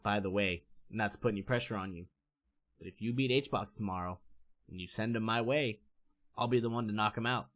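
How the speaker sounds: low, soft imitation of a friend; calmed down. A player offering his friend backup before a match, calmed down and low. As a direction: keep it down low and level, confiding a plan. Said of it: "he calmed down a little bit".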